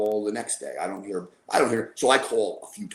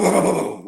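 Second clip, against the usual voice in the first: loud mock thudding booms, pitched up; imitated thuds; 7 semitones higher, 7 dB louder, faster than usual. Recounting the fall on the phone to a friend, imitated booms. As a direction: mimic a body hitting the floor. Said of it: "I heard boom".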